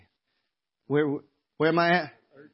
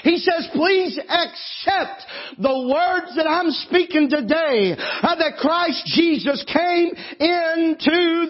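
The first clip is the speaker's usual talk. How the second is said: raised, ringing preacher's voice with catches of feeling; preaching at full force; 6 semitones higher than usual. Acted. Preacher pleading with the congregation to be saved, fervent full-force preaching. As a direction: preach it out, pleading, rising to a cry. Preaching build-up to altar call.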